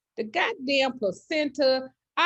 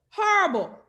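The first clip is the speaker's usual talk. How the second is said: Horrible jumped up higher and quick; higher disgusted repeat; 6 semitones higher than usual. Real, not acted. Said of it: "that shit was horrible".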